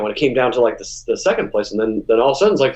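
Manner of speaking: ordinary delivery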